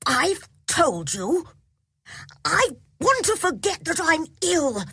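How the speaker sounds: put-on high, imperious boy; prince's voice. A narrator's reading. Colin tells off the doctor, in an imperious prince's voice. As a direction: lay down the law like a little prince. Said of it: "in his prince's voice".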